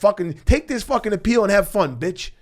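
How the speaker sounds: mock the lawyers who robbed him with hostile contempt. Retelling how the lawyers talked down to him, sneering mock voice. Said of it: "That's what they basically were saying".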